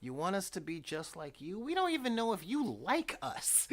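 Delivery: put-on high, protesting teenage whine; exasperated teenager. Tired teenagers answering their parents back, exasperated and high. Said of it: "And we were like".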